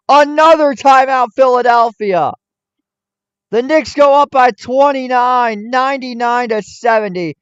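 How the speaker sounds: loud, high, yelling the call as it happens; excited play-by-play. Real, not acted. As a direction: erupt like a play-by-play man on a Knicks run. Fan's live play-by-play call.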